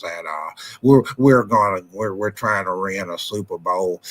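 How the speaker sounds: a deep, trailing mock interview answer; Jerry Jones impression. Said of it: "Jerry Jones, what did you think about the game?".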